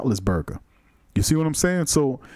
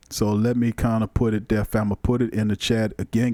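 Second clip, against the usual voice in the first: ordinary talk, nothing marked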